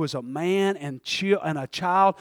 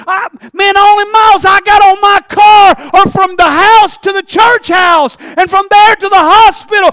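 high, loud, pleading sermon wail; anguished preaching cry; 11 semitones higher, 17 dB louder, slower than usual. Acted. Preacher crying out to God about family troubles.

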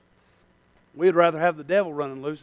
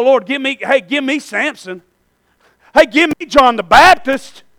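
normal talking voice

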